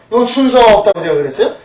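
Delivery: normal talking voice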